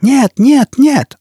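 high-pitched exasperated cry; shouting. A narrator's reading. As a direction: cry out in protest. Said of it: "закричал лохматый".

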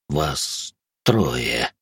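low, slow, sibilant creature voice; lisping ghoul. A narrator's reading. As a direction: draw out the hissing sounds, deep and hesitant. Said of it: "после очередной заминки прошепелявил Гуль". (шепелявый низкий голос гуля, с заминкой)

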